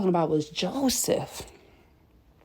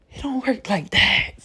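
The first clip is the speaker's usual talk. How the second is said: a quick breathy whisper, higher; whispered aside; 5 semitones higher than usual. Real, not acted. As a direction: lean in and whisper it knowingly. Aside to viewers after a point.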